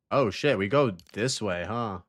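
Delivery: ordinary delivery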